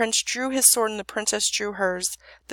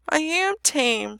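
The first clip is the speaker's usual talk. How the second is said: tearful dragon; 6 semitones higher, much slower than usual. A narrator's reading.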